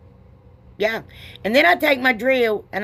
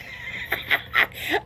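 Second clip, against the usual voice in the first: high breathy shriek of laughter; burst of laughter; 6 dB quieter than usual. Real, not acted. Laughs at lit fuse.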